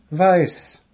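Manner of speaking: high, loud gnome voice; high single firm word. Acted. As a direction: answer firmly. Gnome answers.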